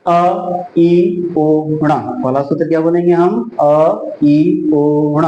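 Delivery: each letter drawn out separately; one by one. Shown as a demonstration. Teacher showing students how to memorise the sutra, one by one. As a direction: recite the sounds singly with gaps. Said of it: "एक एक वर्ण याद करो".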